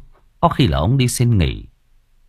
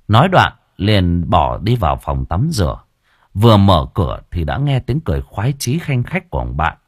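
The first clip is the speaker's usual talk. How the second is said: ordinary delivery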